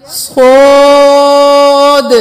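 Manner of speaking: صاد said full-mouthed and heavy, drawn out very slowly. Shown as a demonstration. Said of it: "اب موٹا حرف آ گیا". (صاد موٹا اور کھینچ کر)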